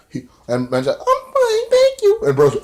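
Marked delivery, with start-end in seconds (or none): 1.0-2.2 tiny, very high falsetto; mock tearful woman. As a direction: squeak it out as a caricature. Retelling Allie's tearful reply.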